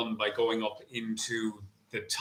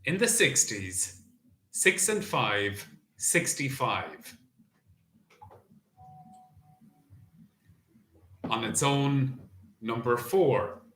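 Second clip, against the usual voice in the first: bingo caller; 3 semitones higher than usual. Acted. Bingo number calling.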